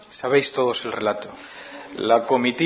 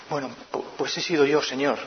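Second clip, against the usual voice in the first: timid confession; 8 dB quieter than usual. Retold or imitated. Mujer escondiéndose confiesa tímida.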